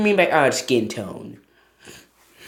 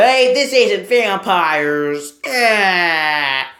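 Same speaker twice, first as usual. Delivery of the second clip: mock surprise; 6 semitones higher, 4 dB louder, much slower than usual.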